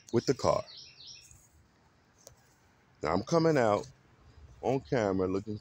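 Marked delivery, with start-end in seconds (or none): none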